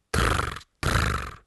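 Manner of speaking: two short rolled trills; beetle trill. Acted. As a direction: buzz twice like an insect. Beetle answers no.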